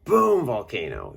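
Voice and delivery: sudden loud high outburst; excited burst. Real, not acted. Vlogger describing past outbursts, loud excited blurt. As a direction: blurt it out like an eruption.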